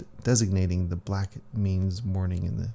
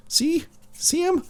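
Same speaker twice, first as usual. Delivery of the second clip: eager high squeak. Acted. Character excitedly points out carving.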